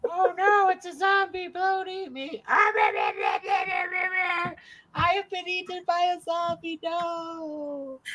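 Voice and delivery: high, soft, hammed-up panic; mock terror. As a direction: play the doomed victim. Mock victim eaten by zombie.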